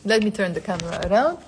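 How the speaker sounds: ordinary talk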